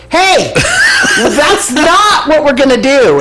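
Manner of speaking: high, loud laughing shout across the bus; retold shout. Telling the singing lady on the bus to stop, loud laughing shout. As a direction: call her out loudly, half laughing.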